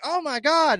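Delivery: high-pitched imitated gasp of surprise; mock shock. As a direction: play the crowd's shocked reaction. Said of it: "everybody was like".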